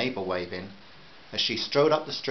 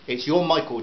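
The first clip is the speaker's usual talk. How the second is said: loud, higher, accusing; louder, higher angry accusation; angry confrontation; 5 semitones higher, 7 dB louder than usual. A narrator's reading. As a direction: point the finger at his son. Angry mother confronts father.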